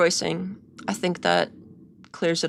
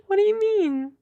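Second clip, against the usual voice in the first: squeaky, much higher "What do you mean?"; high confused quote; 11 semitones higher than usual. Retold or imitated. Vlogger imitating her own confusion, high and squeaky. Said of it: "I was like".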